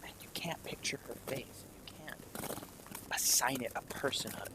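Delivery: hushed, eerie scene-setting whisper; soft whispered game-master narration. A narrator's reading. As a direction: keep the narration down to a haunted whisper.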